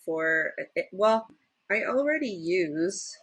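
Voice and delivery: normal talking voice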